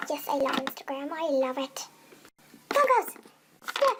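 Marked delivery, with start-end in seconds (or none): 2.7-3.9 high, loud call to a daydreamer; loud, high-pitched summons; insistent shout. Acted. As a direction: shout to jolt someone to attention. Boss calls again, louder.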